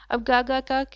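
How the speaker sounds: usual speaking voice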